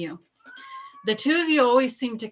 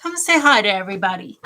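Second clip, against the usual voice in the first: louder, quicker call to the cat; 7 dB louder, faster than usual. Real, not acted. Repeats invitation to cat.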